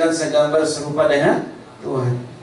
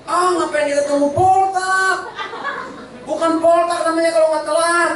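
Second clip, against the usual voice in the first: high, scoffing jibe with a laugh; mocking classmate; 14 semitones higher than usual. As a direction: tease the late friend in a put-on voice. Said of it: "Dia nyaut nih".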